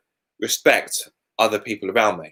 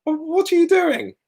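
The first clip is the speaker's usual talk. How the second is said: put-on very high, slow sweet old lady; drawn out like a bewildered granny; slower than usual. Old lady apologising to the filming vlogger, high and slow. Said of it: "But she went".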